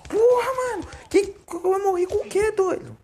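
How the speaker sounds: very high, loud raging outburst; furious yell. Real, not acted. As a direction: explode in frustrated disbelief. Killed in game, rages.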